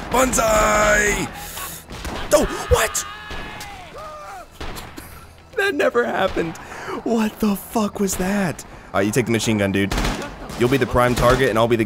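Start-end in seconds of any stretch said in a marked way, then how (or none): 0.1-1.3 high falling battle cry; banzai yell. Real, not acted. Gamer doing the banzai his viewers asked for, yelling. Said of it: "You guys tell me to banzai more". 2.3-8.6 high, shouted shock; stunned disbelief. Real, not acted. Gamer shocked by a glitch, high disbelief. Shocked at weird game event.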